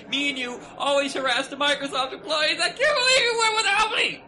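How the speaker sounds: high laughing mock outrage. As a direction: whine it through a laugh.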